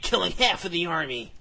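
ordinary delivery

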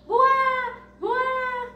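high, hammed-up "Buá, buá" wails; mock crying. Butterfly cries even harder, a high cartoon wail. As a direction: cry it out like a cartoon baby. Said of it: "começou a chorar mais ainda".

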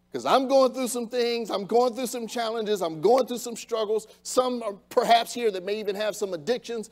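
impassioned sermon at full voice; fervent preaching. Acted. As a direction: preach it with mounting fire. Preacher building to full force.

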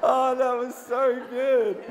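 high, loud and slow through laughter; really cracked up, savouring the joke; laughing delightedly. Real, not acted. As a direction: gasp it out while laughing. Still laughing at the accent joke.

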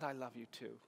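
very soft, low and tender; hushed, near whisper. Retold or imitated. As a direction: murmur it gently into an ear. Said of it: "I remembered how he whispered".

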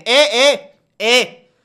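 "¡eh!" imitado, mucho más agudo y fuerte; grito burlón de Twitch. Retold or imitated. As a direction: remeda a Twitch dando el alto a gritos. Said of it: "Coge Twitch y dice". (Twitch prohibiendo, imitado con un grito agudo y burlón)

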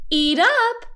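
high-pitched coaxing; sleep-talking wife. A narrator's reading. Wife feeding toast in her dream, high coaxing voice. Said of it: "Missus Watson said in her sleep".

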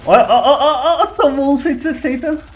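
high, loud laughing brag; giddy shout over a big hit; laughing excitement. Real, not acted. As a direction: gloat through laughter.

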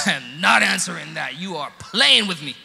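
loud, exasperated mock outburst; angry retort. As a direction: act out being fed up, loudly. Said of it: "I got angry".

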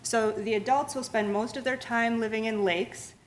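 ordinary talk

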